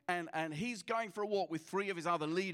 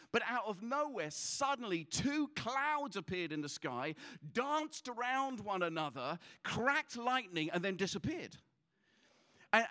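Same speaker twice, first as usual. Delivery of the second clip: usual speaking voice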